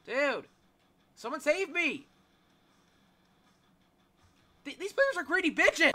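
loud, high, outraged protest; indignant complaint. Real, not acted. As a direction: rant at the teammates who left you. Trapped while others escape.

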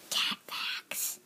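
hushed whispered segment title; soft, unvoiced title whisper; whispered announcement. Whispered segment title, then voiced.

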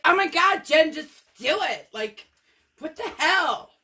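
high, loud, exasperated urging; mock exasperated self-pep talk. Painter goading herself before the pour, high and loud. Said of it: "be like".

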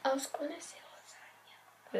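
unvoiced whisper. Real, not acted. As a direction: whisper the guess to yourself. Whispers a guess aside.

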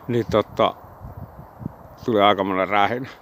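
talking around a cigarette; cigarette in mouth. Real, not acted. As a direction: lips clamped on cigarette. Seen 4: Cigarette held between lips throughout; reference frames show none.

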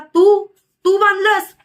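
stunned, drawn-out question; astonished question. A narrator's reading. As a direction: ask it haltingly, amazed, voice lifted. Maharaj amazed by Indu's feat.

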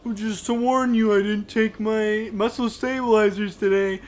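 high, loud put-on voice of a prankster; mock-earnest warning. Bandmate warns band teacher about his muscles, mock-earnest warning. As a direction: imitate a bandmate faking an affliction.

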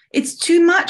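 high, loud cry of protest; shouted. Acted. Summer shouts at the incoming water, high protest. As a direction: shout it with pitch leaping up. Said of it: "shouted Summer".

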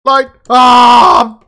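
loud cry of alarm; startled shout. Real, not acted. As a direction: yell out, caught off guard. Lights go out, startled.

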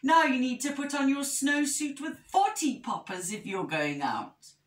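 fussy, high, then dropping at the end; fussing. A narrator's reading. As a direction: fuss like a worried storybook bear. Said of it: "fussed Medium Bear".

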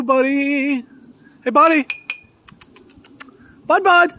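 high, loud, affectionate pet call. Real, not acted. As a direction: call out sweetly and loudly to an animal friend. Calling out to his pet squirrel.

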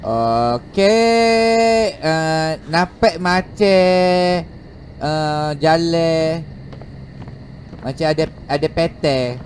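slow Kelantanese with drawn-out "ey" endings; Kelantan accent. Shown as a demonstration. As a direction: stretch each Kelantan ending out slowly.

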